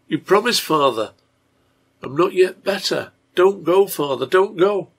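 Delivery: put-on high, loud plea of a feverish girl; pleading child. A narrator's reading. Delirious girl begs her father to stay, pleading.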